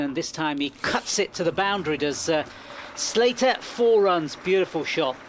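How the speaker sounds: rising excitement as the ball races away; excited commentary. Real, not acted. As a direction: call the boundary with a lift. Boundary called live.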